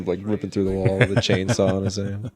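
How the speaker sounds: ordinary delivery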